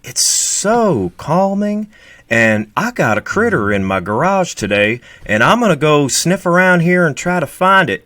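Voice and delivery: high, slow Southern drawl, soothing; Steve's soothing drawl. Retold or imitated. Imitating Steve's calming channel voice for Lewis, slow drawl. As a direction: lull them like Steve's calm videos. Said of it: "Steve is like".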